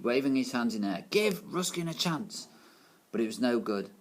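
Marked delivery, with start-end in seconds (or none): 1.1-2.5 higher, slow desperate plea to the class; pleading teacher. A narrator's reading. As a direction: beg the class to listen. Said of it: "Mister Lace pleaded".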